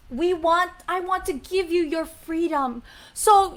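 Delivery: ordinary delivery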